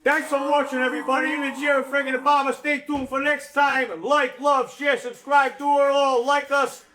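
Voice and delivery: show sign-off. Pretend. Host closing the show to viewers, performed sign-off.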